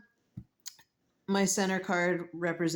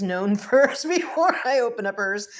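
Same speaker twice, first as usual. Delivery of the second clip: louder, laughing partway through; 5 semitones higher, 8 dB louder than usual. Real, not acted. Vlogger admits she put her book first, laughing louder. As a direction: confess it with a laugh.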